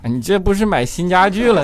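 normal talking voice

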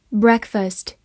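ordinary delivery

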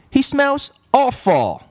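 put-on high girl voice, disgusted; Leslie. A narrator's reading. Girl tells teacher the new boy stinks, high and disgusted. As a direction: squeal it up in disgust.

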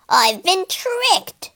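high, slow, tight anger; king fuming at being fooled. Acted. King realises Jason tricked him, fuming. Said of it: "he fumed".

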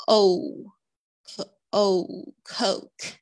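"O" and "Coke" with a long gliding O, said very slowly; slow model of the O vowel in "Coke"; careful O sound. Shown as a demonstration. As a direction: stretch the O in "Coke" into a clear glide. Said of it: "注意一下你们O的发音".

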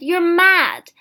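high, very loud accusation; appalled outburst; horrified cry. A narrator's reading. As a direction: shout in disbelief.

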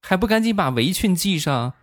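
put-on high child's voice scolding his dad; a small boy snapping orders at grown-ups; bossy little kid. Nephew scolding his stunned dad, high bossy child voice. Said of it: "就冲他爸爸啊".

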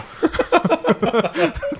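high rising giggle; high laugh. Real, not acted. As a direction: giggle at the memory. Laughing after boxing line.

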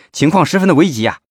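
pressing, high-pitched urgency; urgent report. A narrator's reading. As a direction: report an emergency in a hurry.

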